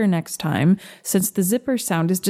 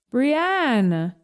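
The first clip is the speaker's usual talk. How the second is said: drawn-out name on a raised pitch; calling out; 5 semitones higher than usual. A narrator's reading. Mama summoning her child, calling out lightly. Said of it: "Mama calls".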